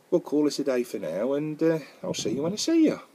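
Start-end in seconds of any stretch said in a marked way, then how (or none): none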